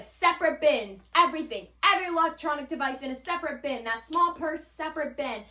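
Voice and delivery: loud, high mock command to a crowd; retold yelling. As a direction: imitate an agent yelling orders at everyone. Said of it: "like, yelling at everyone".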